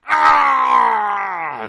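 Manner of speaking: loud pained roar; monster roar. Acted. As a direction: bellow as arrows hit. Arrows lodge in boss's back.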